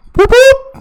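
very high sharp pup sounds; mimicked police knock. Storyteller imitates police knocking on the van window, sharp and high. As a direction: imitate cops rapping on the van.

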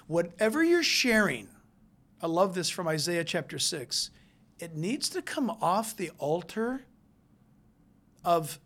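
ordinary talk, nothing marked

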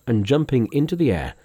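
ordinary delivery

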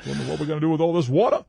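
quick mock Maine accent; broad Down East vowels, Maine style. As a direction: play a Mainer puzzling aloud. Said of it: "That accent is so cool".

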